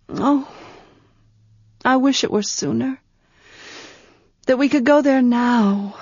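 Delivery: wishing it were already so; longing young woman. Acted.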